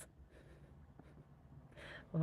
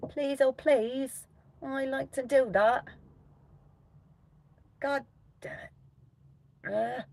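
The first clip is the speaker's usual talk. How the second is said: whiny pleading; 10 semitones higher, 6 dB louder, slower than usual. Real, not acted. Begging app to load.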